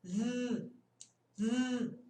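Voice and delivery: z buzzed with the tongue between the teeth; voiced TH buzz. Shown as a demonstration. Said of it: "Vai sair isso aqui". (z vibrando com a língua entre os dentes)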